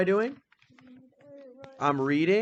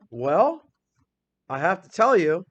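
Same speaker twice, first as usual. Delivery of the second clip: ordinary delivery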